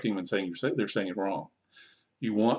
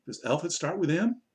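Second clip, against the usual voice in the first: higher, rising teacher question; quizzing pupils; 6 semitones higher than usual. Shown as a demonstration. Teacher quizzing young learners on letters, high rising question. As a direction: lift the pitch to prompt an answer.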